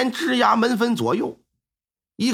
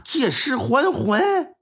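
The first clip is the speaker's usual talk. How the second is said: scoffing; 6 semitones higher, much slower than usual. Acted. Squire scoffs in thought.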